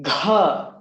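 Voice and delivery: gha with a strong breath after the g; breathy gha; aspirated gha. Shown as a demonstration. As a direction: push air out on gha. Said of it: "You should feel the breath there".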